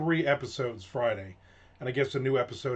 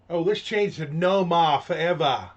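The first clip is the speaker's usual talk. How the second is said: loud, thick fake Southie accent; mock Boston accent; 3 semitones higher, 5 dB louder than usual. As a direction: lay the Boston accent on, over the top. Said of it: "Southie Boston accent".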